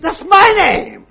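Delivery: very high, loud, slow outburst; furious. Acted. Alexander furious at the soldier for sharing his name, very high and loud. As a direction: hammer MY like a furious king. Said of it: "went into a rage".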